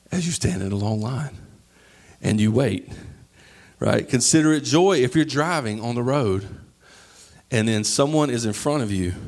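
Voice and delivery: ordinary talk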